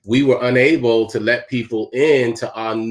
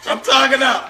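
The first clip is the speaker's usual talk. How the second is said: mocking wife's voice. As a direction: squeak it out like the prim wife. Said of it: "She gonna say".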